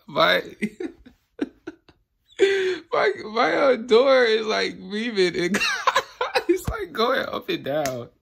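high, helpless giggling through the words; real giggle fit breaking up the speech; laughing. Real, not acted. Tripper telling viewers the door is weaving, laughing.

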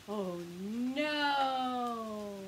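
plain reading voice

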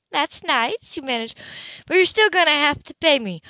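an easygoing neighbour offering help; Grace, friendly. Acted. Narrator voices Grace.

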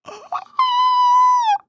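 sharp high shocked gasp; a big, high, loud gasp. Real, not acted. Emotional game ending.

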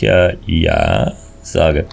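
put-on very old man, low and slow; creaky, slow elderly voice. Shown as a demonstration. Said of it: "ich habe ja auch so eine knarzige Stimme".

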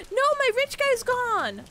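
loud, high, slow lament; dramatic dismay. Pretend. Streamer laments losing the rich guy in game, dramatic dismay. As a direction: cry out in mock despair.

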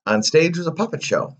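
plain reading voice

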